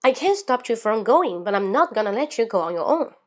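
quick linked read, CAN'T lifted, no breaks; fast, in one breath. Shown as a demonstration. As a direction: run the whole sentence through without stopping. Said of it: "再来一遍". (一口气快速读完，连读不停顿)

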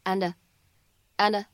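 "and a" run together as one word; linked. Shown as a demonstration. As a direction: link "and a" smoothly, twice. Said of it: "可以有一个连读的处理". ("and a"连读)

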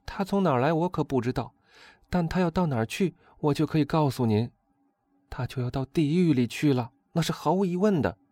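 high, emphatic noblewoman; alarmed countess. A narrator's reading. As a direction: pronounce his doom in a high, insistent voice.

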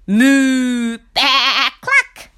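loud moo, baa and cluck; animal chorus. A narrator's reading. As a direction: blast the farmer with animal noises. Animals answer the farmer.

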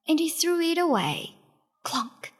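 ordinary delivery, nothing marked

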